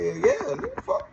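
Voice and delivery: shooting way up high with excitement; eager yes. Retold or imitated. Answering the old man's money offer, excited high yelp. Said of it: "I'm like".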